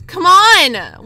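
exasperated shout. Real, not acted. Gamer urges the player on, exasperated shout.

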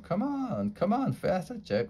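high, rushed urging; urgent. Acted. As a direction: hurry him along. Urgent push to hurry.

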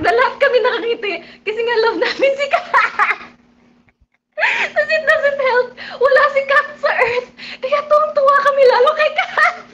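high, loud laughing chatter. Real, not acted. Vlogger laughing with fans about Kath, high and loud.

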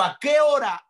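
high, loud preacher's re-enactment; urgent acted question. Preacher voices the centurion asking the hour of healing, urgent.

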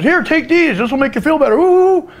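high, eager mock pill-pusher; hammed-up high coaxing; Cronin. Voices Cronin offering pills.

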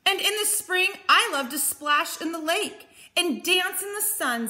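high, eager bear character; animated, high-pitched children's story voice; excited storybook Bear. Acted. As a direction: gush happily as a playful bear.

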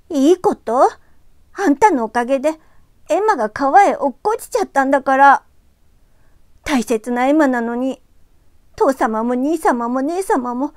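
high, loud tearful scolding; furious girl. Acted. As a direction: berate him in a fury. ユキが男の子を激しく責める.

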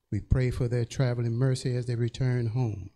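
ordinary talk, nothing marked

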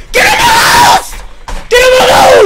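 very high, full-force scream. Real, not acted. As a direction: scream at the top of your voice. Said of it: "ich hab zu laut geschrien". (aus vollem Hals schreiend)

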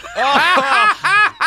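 high, bubbling laugh; delighted laugh. Real, not acted. Host cracking up when the guest refuses, high laughter.

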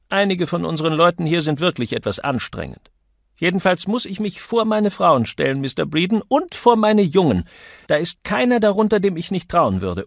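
high, loud, scolding woman; indignant Misses Johnson. A narrator's reading. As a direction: tell him off from on high. Indignant woman defending her staff.

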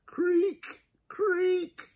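very high, squeaky creak sound; creaking chandelier. A narrator's reading. Imitates swinging chandelier's creak.

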